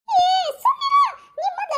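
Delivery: high, loud shout to hush her. Acted. Mother-in-law snapping to be quiet.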